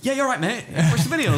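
mock forced politeness. Forced polite reply to fan.

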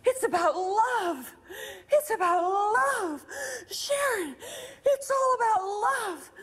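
high, tearful, drawn-out cry; imitated wailing, impassioned insistence; intense revelation. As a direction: cry it out slowly as a desperate revelation. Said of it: "started this kind of intense".

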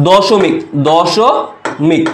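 দশমিক, দশমিক said very slowly and deliberately; slow emphasis. Shown as a demonstration. Tutor writing on board, saying দশমিক slowly. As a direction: spell out দশমিক twice, unhurried. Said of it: "ভাই একটু খেয়াল করো তো".

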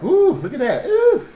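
a tiny squeaky crab voice with a laugh; voicing the crab. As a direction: play the crab gasping in surprise. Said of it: "the shit's like".

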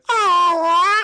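imitating a friend's high yelped oh; mock high oh. Mimicking how the caught friend yelped, a high oh. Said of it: "He just went".

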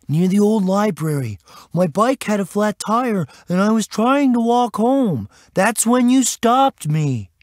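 put-on high, put-upon kid voice; high-pitched aggrieved youngster; boy explaining. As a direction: explain yourself in a boy's high voice. Boy explains himself to officer.

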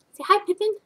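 high cooing pet voice. Real, not acted. Owner coaxing her ferret to greet viewers, baby talk.

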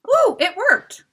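high, loud excited squeal; delighted squeal. Acted. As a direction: burst out with glee. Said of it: "clapped her hands, delighted".

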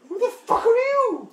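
high, loud squawk of outrage; startled yell. Real, not acted. Gamer challenging an unknown game character, high-pitched yell. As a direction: shriek it at a stranger who butts in.

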